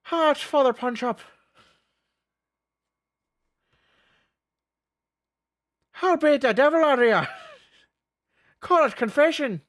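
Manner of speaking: high, loud mock Irish accent; brawling Irish priest, hammed up; Father Punch Up. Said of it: "religious combo with a, with an accent".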